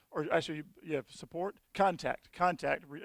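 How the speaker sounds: ordinary talk, nothing marked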